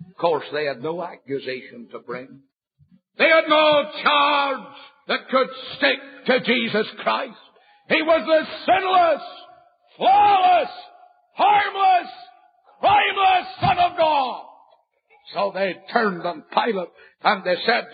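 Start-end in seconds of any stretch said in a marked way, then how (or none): 3.2-14.4 pulpit-pounding revival preaching; preaching at full force. Acted. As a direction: thunder it at the young sinner. Extolling Christ's sinlessness.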